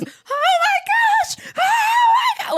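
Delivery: shrieking, very high and slow, laughing through it; a friend imitating a girl's panicked shriek over a bug; retold panic. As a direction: shriek in mock horror at what you see. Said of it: "Like, shaking, scared".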